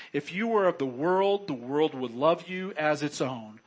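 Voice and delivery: scripture reading. Acted. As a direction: read the verse out with weight, slowing on "chose". Reads scripture aloud to church.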